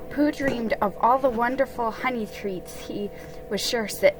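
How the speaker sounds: usual speaking voice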